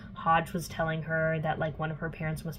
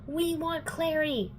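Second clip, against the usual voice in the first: high, slow, drawn-out mock demand; werewolf pack demand; 9 semitones higher than usual. Retold werewolves confronting the vampires, mock demand.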